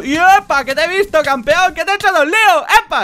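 high, loud triumphant yells; real excited gloating; gloating shout. Real, not acted. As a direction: whoop it out as you catch him. Ambushing enemy player.

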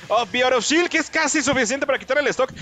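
loud, rising play-by-play; excited call. Real, not acted. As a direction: hype up the big hit. Commentator reacting to a play. (narración emocionada)

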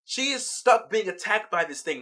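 ordinary delivery

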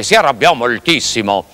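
ordinary delivery

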